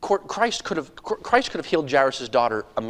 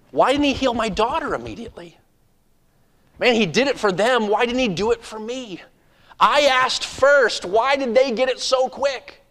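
high, aggrieved protest, building louder; indignant complaint; 9 semitones higher, 5 dB louder than usual. Acted. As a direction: protest the unfairness like one passed over. Jairus resenting the delay while walking.

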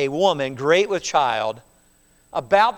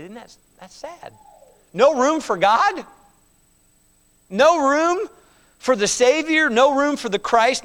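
rising, louder cries of disbelief; shocked dismay; 7 semitones higher, 5 dB louder than usual. Retold or imitated. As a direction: ask it as if scandalized. Listeners' shocked reaction voiced.

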